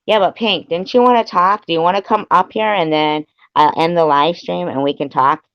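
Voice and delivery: high, loud eager appeal; calling out. Real, not acted. Streamer inviting Pink up to talk, calling out loudly. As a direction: call out to someone to come join you.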